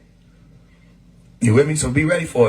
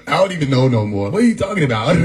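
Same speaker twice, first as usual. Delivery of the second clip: normal talking voice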